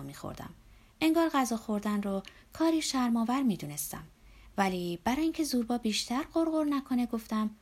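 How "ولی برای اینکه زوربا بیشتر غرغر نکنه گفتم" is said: ordinary delivery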